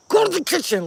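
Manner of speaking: shouted spirit command. Retold or imitated. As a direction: imitate an urgent voice yelling an order. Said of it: "Like, somebody said that to me".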